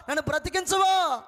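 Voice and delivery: loud, high shouted plea; desperate shout. Retold or imitated. Dying man screaming to God to let him live, full shout. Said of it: "అని కేకలు పెడతా ఉంటే".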